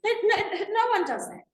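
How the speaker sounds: a high, loud laugh through the words. Real, not acted. Laughing as she dismisses the idea to the audience.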